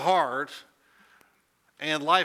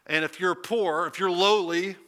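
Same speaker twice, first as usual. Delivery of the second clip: ordinary talk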